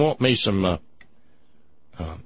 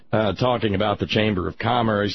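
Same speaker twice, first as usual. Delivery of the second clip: normal talking voice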